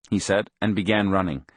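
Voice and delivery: plain reading voice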